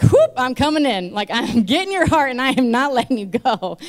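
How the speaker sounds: high, loud, gleeful charge; exuberant, forceful declaration. Retold or imitated. God barging in to claim her heart, exuberant. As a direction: burst in and claim them, laughing.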